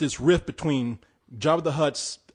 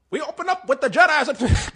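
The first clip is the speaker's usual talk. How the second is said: high newsreel voice, with a laugh; hammed-up announcer read, laughing; old newsreel; 10 semitones higher than usual. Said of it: "he was kinda like".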